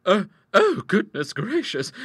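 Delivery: high, loud, halting fluster; falter, then exclaim in alarm; rising alarm. Acted. Sister answering as she spots the snake, alarmed.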